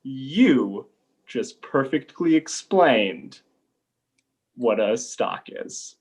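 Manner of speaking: slow, weighty pronouncement; measured, dramatic reveal; slow and deliberate. Acted. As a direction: spell out the point with long pauses. Teacher triumphantly makes his point.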